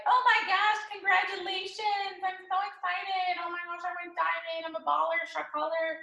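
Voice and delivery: high, squealing mock delight; over-the-top girly celebration; giddy excitement. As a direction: squeal like a new diamond showing off. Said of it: "you're like".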